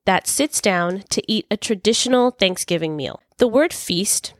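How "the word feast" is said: ordinary talk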